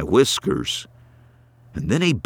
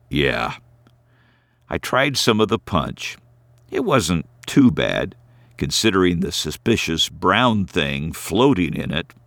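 ordinary delivery

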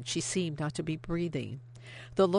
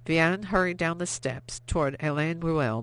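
plain reading voice

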